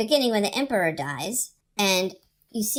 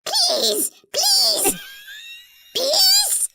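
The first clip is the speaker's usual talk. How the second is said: high, loud, whimpering wail; crying Chamberlain; 5 dB louder, much slower than usual. Retold or imitated. Imitating the Chamberlain's loud crying plea. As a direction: wail for peace, half sobbing. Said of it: "his voice just cries".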